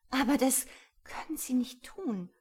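high, halting, dismayed plea; distressed whisper. A narrator's reading. Woman pleads against being reported, distressed. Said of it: "flüsterte sie".

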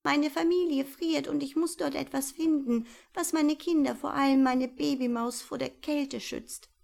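father mouse worried about his freezing family. Acted. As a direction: fret for the children.

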